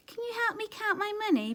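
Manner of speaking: high, quick polite asking; high polite little plea; polite wolf. Acted. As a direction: sweetly beg for help.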